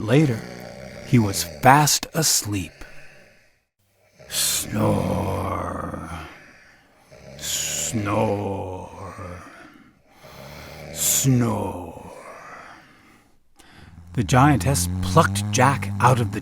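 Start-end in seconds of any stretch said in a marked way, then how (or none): 4.3-12.1 giant snoring. Acted. Giant fast asleep.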